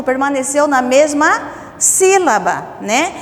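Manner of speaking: ordinary delivery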